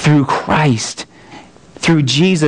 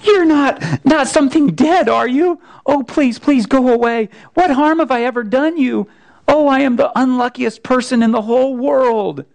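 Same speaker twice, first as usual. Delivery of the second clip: put-on much higher, panicked pleading boy; terrified near-scream; 12 semitones higher than usual. A narrator's reading. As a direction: plead in rising terror. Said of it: "almost in a scream".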